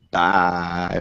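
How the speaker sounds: drawn-out sign-off with echo; echoey farewell; echoey effect. Real, not acted. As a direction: let the goodbye ring out in echo. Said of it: "put a little echoey effect at the end".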